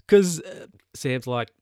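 usual speaking voice

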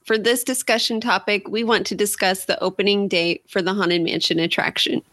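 ordinary delivery

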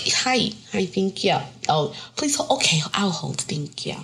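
lightly sweet, careful phone manners; code-switched phone voice. Retold or imitated. Grown-up caller on hold, in a soft polite voice. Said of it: "it's code switching".

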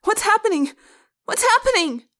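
very high, loud screamed question; panicked screaming. A narrator's reading. Frightened pupil watching teacher collapse, screaming. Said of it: "One of the humans began screaming".

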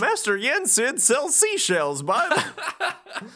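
high goofball voice cracking into laughter; Goofy. Acted. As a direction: stumble into a tongue twister in character, laughing. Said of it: "Goofy says".

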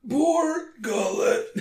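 put-on high, slow, dying old man; constantly dying voice. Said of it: "I'm constantly dying" voice".

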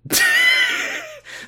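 very high cracking laugh; squealing laugh. Real, not acted. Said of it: "I'm not too good at doing your voice".

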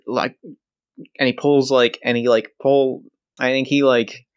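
ordinary talk

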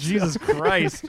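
slow, drawn-out Jesus Christ; exasperated. Real, not acted. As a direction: drag it out in disbelief. Exasperated exclamation.

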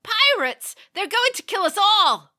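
high, loud panicked screaming; terrified sailor. A narrator's reading. Sailor screaming in terror at the boarding pirates. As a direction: shriek it in terror.

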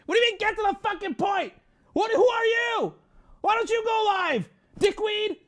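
high, loud indignant outburst; irate comeback. Real, not acted. Streamer telling off a chatter who wants the point, irate. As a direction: round on the heckler in a high yell. Snapping back at a chat comment.

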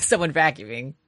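ordinary delivery

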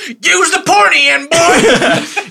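high, loud distant yell; shout from afar. Acted. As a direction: bellow it across a big distance. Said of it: "Can Yardley shout from".

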